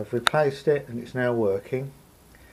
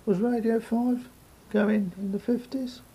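mock puzzled; 10 semitones higher than usual. Mock puzzled question about dial.

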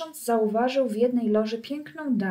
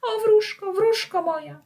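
much higher, louder cry of joy; joyful cry; 12 semitones higher, 5 dB louder than usual. Acted. Pinocchio crying out to the fairy, high and joyful. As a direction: call out to her with all your heart. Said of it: "usiłował krzyknąć".

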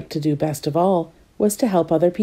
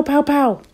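gunshot sounds; 8 semitones higher than usual. Acted. Rifle shots voiced.

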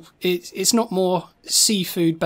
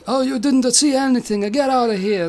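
put-on higher Italian-accented old man; Da Vinci voice; 5 semitones higher than usual. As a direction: shoo them off in a put-on Italian voice. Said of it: "he's like".

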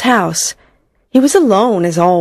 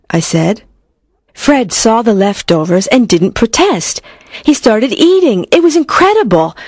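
usual speaking voice

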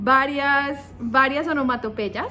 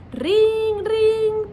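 phone ringing; 9 semitones higher, 5 dB louder than usual. Shown as a demonstration. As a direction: ring ring high and loud like a phone. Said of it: "para un teléfono". (ring ring agudo y fuerte de teléfono)

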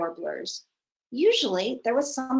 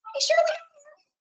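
high insect voice. Acted. Darner answers.

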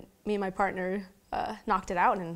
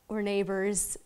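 normal talking voice

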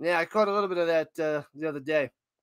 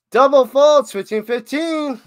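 high, loud announcement; excited fault call; 7 semitones higher, 9 dB louder than usual. Real, not acted. Commentator announcing fault and score, excited shout. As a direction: holler the fault, then the score.

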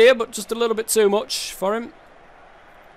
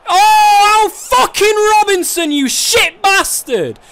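very high, loud raging scream; furious yell; 13 semitones higher, 7 dB louder, slower than usual. Real, not acted. Gamer screaming at Robinson for missing a sitter, raging. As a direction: explode at the useless striker. Striker misses another chance.

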